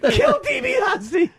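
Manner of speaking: high cheering fan, drawn out; excited fan yelling. Retold or imitated. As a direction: scream for the turn like a kid fan. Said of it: "How excited you were".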